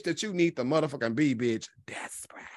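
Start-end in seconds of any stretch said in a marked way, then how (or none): 1.9-2.4 whispered aside; a sly whispered jab. Real, not acted. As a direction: drop to a whisper.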